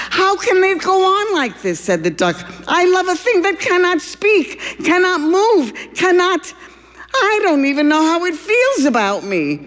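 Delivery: high, strained lovesick duck; anguished lover. Acted. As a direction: wail your hopeless love, high up.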